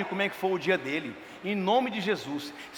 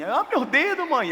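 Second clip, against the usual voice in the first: high, hammed-up moan of pain; fake moan; 6 semitones higher, slower than usual. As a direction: whine to mum as if badly hurt. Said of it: "Tu tem que dar uma gemida mais assim".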